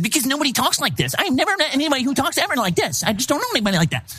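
high, mocking imitation of a whiny voice; mocking exaggerated tone. Dad parodying his daughter's retold tone, high and mocking. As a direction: parody the over-the-top tone. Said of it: "I said".

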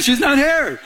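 high-pitched mock reminder; comic repeat. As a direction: repeat the verdict high, for laughs. Comic repeat of God's verdict.

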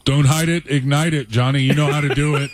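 playful hype cheer for the host; hype catchphrase. Acted. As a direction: fire him up like a hype man.

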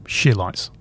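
"Sheer Lights" starting SH plus long EE; SHE-lites. Shown as a demonstration. Host joking on the Chicago band's name, Sheer Lights as SHE-lites. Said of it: "should be called".

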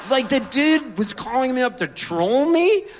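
loud, high-pitched outraged rant; mock indignation. Comic telling his crowd about being trolled, indignant.

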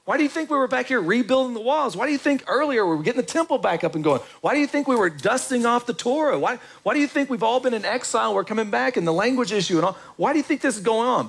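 high, pleading prophet pleading with the people; exasperated leader scolding his people, voiced high; Nehemiah rebuking. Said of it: "He's like".

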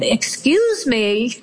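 seething mother interrupting the senator, slowly; pointed interruption. Retold or imitated. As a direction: cut in with icy politeness. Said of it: "And now I'm seeing red".

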